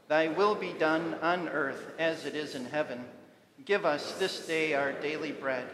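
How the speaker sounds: ordinary delivery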